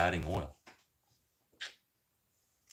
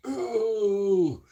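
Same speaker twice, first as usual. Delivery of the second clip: high mock bird coo; 6 dB louder than usual. Bird spreading its wings.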